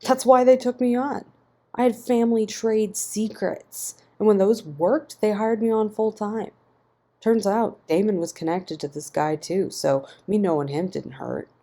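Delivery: angry defiance thrown at Ian. A narrator's reading. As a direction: fire it back in anger. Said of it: "His tone shifted, anger taking over".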